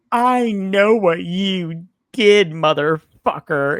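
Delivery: loud, slow, drawn-out mock accusation; hammed-up public outburst, every word stretched high; losing her shit. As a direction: play her unhinged confrontation. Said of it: "And she loses her shit".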